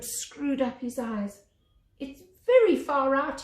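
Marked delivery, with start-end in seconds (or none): 2.0-3.4 nervous. Acted. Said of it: "he said rather nervously".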